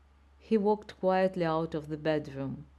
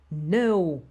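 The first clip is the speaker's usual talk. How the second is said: defiant no; 6 dB louder than usual. A narrator's reading. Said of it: "I said again".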